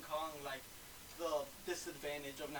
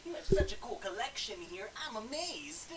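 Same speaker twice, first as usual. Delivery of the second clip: put-on high, eager gee-whiz kid voice; wholesome 1950s kid; 6 semitones higher, 3 dB louder than usual. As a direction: gush like a 1950s kid seeing toys. Gushing over figure collection.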